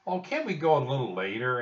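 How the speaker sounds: put-on a touch of childlike begging; pleading. A narrator's reading. Annie asking Jack to stay a little later, pleading.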